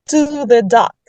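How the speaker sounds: usual speaking voice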